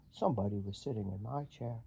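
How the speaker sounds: low big bear voice; Papa Bear. Acted.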